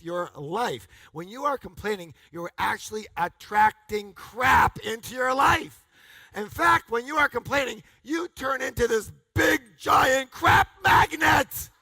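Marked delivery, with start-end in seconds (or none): 2.3-11.7 rising to a much higher, louder yell; building shout. Real, not acted. Speaker preaching to the crowd, building shout. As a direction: build to a laughing roar.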